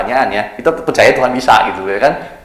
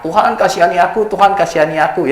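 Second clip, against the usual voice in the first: high pleading cry; crying out for mercy; 8 semitones higher than usual. Retold or imitated. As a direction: call out to the Lord, begging. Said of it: "waktu dia teriak". (seruan memohon belas kasihan)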